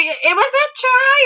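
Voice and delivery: high, slow, drawn-out insistence; a high, drawn-out mock plea that it counts; insistent. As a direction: plead the call as a fan. Arguing with her dad over TV.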